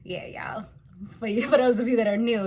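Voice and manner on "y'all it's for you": ordinary talk, nothing marked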